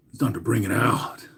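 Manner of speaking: whispered, teasing the big reveal; dramatic whisper. Acted. Whispering to the stream audience, teasing the reveal. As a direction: hush it like a secret ceremony.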